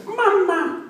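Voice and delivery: put-on squeaky high baby cry of Mama; goldfish baby. A grown man playing a newborn crying for mama. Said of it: "we'd be like".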